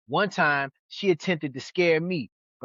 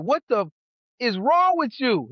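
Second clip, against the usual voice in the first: higher, louder what the; shocked yell; 7 semitones higher, 4 dB louder than usual. Retold or imitated. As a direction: half-shout at a friend who's choking. Shouting at friend after the shot.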